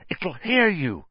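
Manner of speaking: high, urgent warning; frantic, strained high voice. A narrator's reading. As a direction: warn them in a panic. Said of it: "said Harry frantically".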